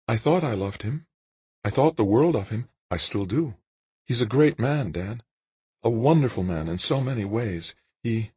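high-pitched woman's character voice; Jane, earnest. A narrator's reading. Jane tells Dan about her husband, high earnest woman's voice. Narrator voices Jane's dialogue.

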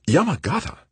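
high, incredulous echo of the name; startled disbelief. A narrator's reading. Mother cutting in on her son's threat, startled. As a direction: blurt it as a shocked question.

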